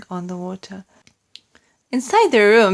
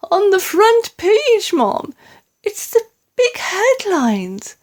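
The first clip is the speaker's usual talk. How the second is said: upset maid; 12 semitones higher, 4 dB louder, slower than usual. A narrator's reading.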